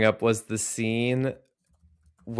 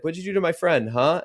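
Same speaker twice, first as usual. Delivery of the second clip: higher-pitched retold grilling; mock interrogator; 7 semitones higher, 3 dB louder than usual. Retelling him grilling the guy about his friend, mock interrogation. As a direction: lightly act out an accusing interrogation. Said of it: "when he's interrogating the guy".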